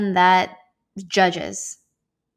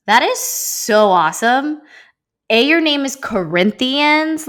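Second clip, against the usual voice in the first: louder, higher burst of delight; excited; 7 semitones higher, 6 dB louder than usual. Retold or imitated. To the man named Corinthians, excited and delighted. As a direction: gush with amazement at his name.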